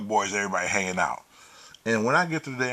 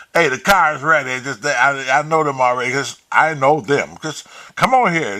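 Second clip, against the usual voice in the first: insistent calling; 4 semitones higher, 7 dB louder than usual. Retold or imitated. Calling her back to the car.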